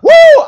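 high, loud whoop; victory whoop. Acted. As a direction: shout it like a winning crowd. Israel celebrating victory.

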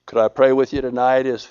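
quiet, gentle invitation to prayer; soft altar call. Acted. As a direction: draw them in softly, unhurried.

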